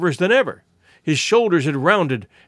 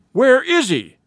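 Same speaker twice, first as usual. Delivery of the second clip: urgent, higher-pitched gasp of a question; 7 semitones higher, 4 dB louder, slower than usual. A narrator's reading. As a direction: blurt it out in acted shock. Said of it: "he gasped".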